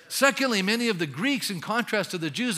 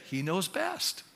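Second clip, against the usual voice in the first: softer He knows best, said resignedly; meek surrender; 5 dB quieter, slower than usual. Shown as a demonstration. Preacher voicing a believer, softly. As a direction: give in quietly. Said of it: "why don't we just say".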